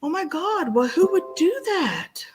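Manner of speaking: high pitched mock dismay; mock horrified Muslims. Imitating shocked Muslims over the dead pig. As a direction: gasp in exaggerated pity. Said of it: "the Muslims were like".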